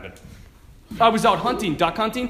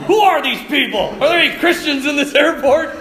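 high, loud indignant questioning; mock outrage; 7 semitones higher, 9 dB louder than usual. Priest scolding airport crowd in his story, mock outrage. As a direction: demand answers in exaggerated disbelief.

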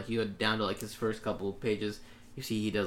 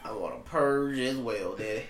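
teaser trailer voice. Retold or imitated. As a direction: draw it out, imitating the trailer. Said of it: "teaser trailer voice".

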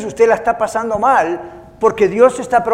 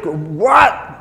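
indignant what; 2 semitones lower, 3 dB louder than usual. Retold or imitated. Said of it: "adentro uno dice".

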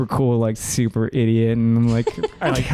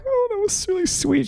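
mock teary gush. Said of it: "I'm like".

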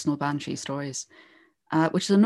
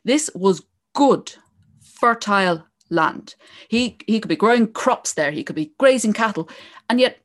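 epic fantasy narrator; 2 semitones higher than usual. Acted. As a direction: build the legend like a fireside tale. Starts telling the O'Neill legend.

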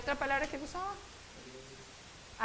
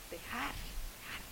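slow breathy whisper; 9 dB quieter, much slower than usual. Acted. Preacher quietly urging letting go, whispered. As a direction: drop to a slow hush on each word.

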